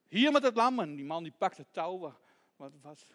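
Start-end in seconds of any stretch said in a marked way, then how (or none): 0.1-0.8 loud, high-pitched order; angry demand. Acted. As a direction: demand it back forcefully. Thief grabs for the lamb.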